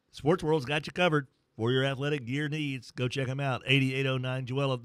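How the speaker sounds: upbeat radio sponsor read; live ad read. Acted. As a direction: sell the sponsor like a morning show host.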